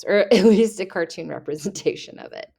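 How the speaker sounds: ordinary talk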